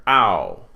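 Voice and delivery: "Ow" as one blended vowel sound; one-syllable "Ow"; diphthong pronounced together. Shown as a demonstration. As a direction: run the vowels of "Ow" together into a single glide. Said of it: "they're pronounced together".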